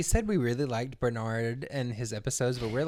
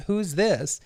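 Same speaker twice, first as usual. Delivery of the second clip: lightly acted puzzled man on the phone; a slightly raised, hesitant question; lightly voiced Larry; 6 semitones higher than usual. Larry asks who is on the line, lightly imitated. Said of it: "and he's like".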